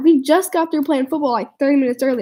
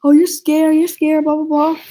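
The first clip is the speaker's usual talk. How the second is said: higher, louder schoolyard taunt; mocking taunt; 4 semitones higher, 3 dB louder than usual. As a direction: jeer that he's scared, pitched up. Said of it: "He's all".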